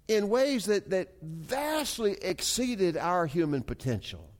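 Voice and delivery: high, slow, stretched preaching cadence; emphatic preaching. Real, not acted. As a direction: dwell on each phrase with rising conviction.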